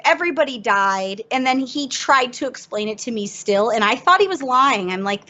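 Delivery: ordinary talk, nothing marked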